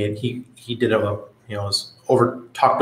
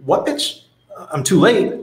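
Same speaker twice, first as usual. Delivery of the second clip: incredulous angry retort; 9 semitones higher than usual. Retold or imitated. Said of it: "It's like".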